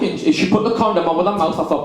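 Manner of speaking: ordinary talk, nothing marked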